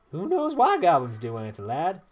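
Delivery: put-on lively, swooping southern-accented yarn; old-timer storyteller. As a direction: recount old battles with relish. Voices Traherne ranting about goblins.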